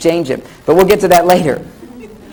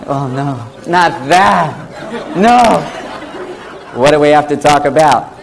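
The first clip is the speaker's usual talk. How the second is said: mock dread; 4 semitones lower, slower than usual. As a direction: groan it like doom is coming. Man's panicked reaction.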